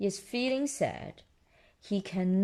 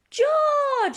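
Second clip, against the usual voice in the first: much higher, louder call of the name; Peppa calling out; 7 dB louder than usual. Acted. Big sister woken at night, high loud call.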